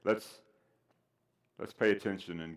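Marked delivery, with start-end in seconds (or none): none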